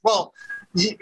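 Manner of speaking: usual speaking voice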